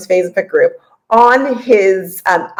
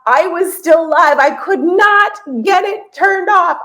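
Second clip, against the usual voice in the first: frantic retelling; 8 semitones higher, slower than usual. Retold or imitated. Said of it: "He said".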